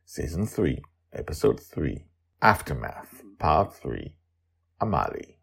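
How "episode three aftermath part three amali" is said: ordinary delivery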